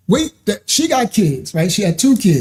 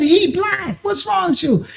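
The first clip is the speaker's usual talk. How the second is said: high, shouted disbelief; retold outraged shout; exasperated yell; 12 semitones higher, 3 dB quieter than usual. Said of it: "I said".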